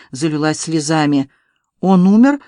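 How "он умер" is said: louder question with eager curiosity; curious. A narrator's reading. Onlooker asks about the fallen duellist, curious. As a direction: ask with open, lively interest. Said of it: "с любопытством спросил Изамбар".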